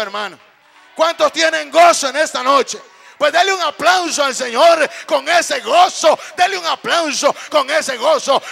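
very loud, rousing sermon delivery; full-force preaching. Real, not acted. Preacher calling for applause for the Lord, full-force preaching. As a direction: whip the congregation into applause.

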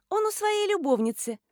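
put-on high, offhand girlish voice; teenage girl. A narrator's reading. Schoolgirl delivers the paid-for secret, offhand girlish voice. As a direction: toss out the secret lightly. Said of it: "школьница".

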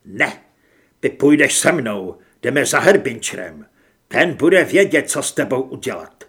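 loud, firm refusal and order; forceful command. Acted. Narrator's character orders someone to come see Herbinčer, loud commanding voice. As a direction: order them along, loud and insistent.

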